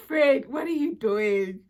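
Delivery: loud, slow, disbelieving call; exasperated friend. Retold or imitated. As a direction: call her out, stretched and loud. Friend scolding across car.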